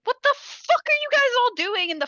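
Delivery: a mom barging in, baffled and annoyed; startled mom. Retold or imitated. Mom bursts in on kids.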